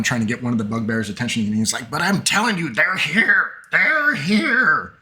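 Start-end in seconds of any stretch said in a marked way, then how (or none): 1.9-4.9 high-pitched, insistent goblin cry; goblin screaming. Acted. Goblin trying to get a bugbear's attention, high insistent cry. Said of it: "he's screaming".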